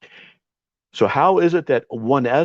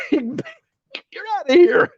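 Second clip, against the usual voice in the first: much higher, slow, drawn-out mock voice of the host; mocking the banning host; much slower than usual. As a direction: stretch it out, mocking the man who bans him. Mocking opponent banning him.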